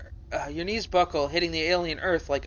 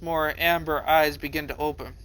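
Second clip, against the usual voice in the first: ordinary delivery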